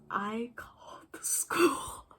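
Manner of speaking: slow, half-whispered admission; acted-out guilty reveal, drawn out; hushed confession. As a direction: confess it slowly under your breath. Friend confessing what she did.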